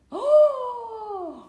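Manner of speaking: high, slow delighted coo. Real, not acted. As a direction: gush over the new toy. Excited over new toy.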